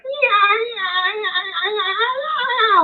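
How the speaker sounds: very high yipping wail; crazy coyote cackling. Retold or imitated. Heard over the horizon, imitated crazy cackling. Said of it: "this crazy cackling".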